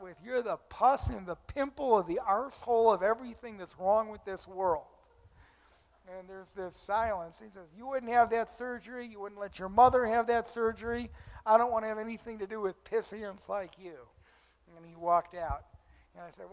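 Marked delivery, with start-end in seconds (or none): 0.2-4.9 loud, raised-pitch retold insult; imitating a furious heckler; acted angry tirade. 7.8-14.0 louder, higher retold accusation; imitating an indignant heckler; acted angry rebuke. Retold heckler berates the meeting.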